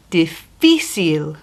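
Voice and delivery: Difícil said slowly, stress on fi; Difícil, dee-FEE-seel, drawn out; Difícil with the weight on fi; slow teaching demo. Shown as a demonstration. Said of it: "the strong part of the word is".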